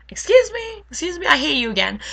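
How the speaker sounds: high, loud affronted outburst; mock indignation. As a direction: act offended, starting high and loud. Retold indignant reaction to insult.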